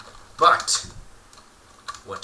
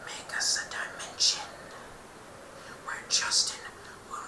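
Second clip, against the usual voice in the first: soft otherworldly whisper; eerie creature whisper; 9 dB quieter than usual. Acted. The Enderman tells Kenneth his fate in a whisper. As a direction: whisper like a being from another world.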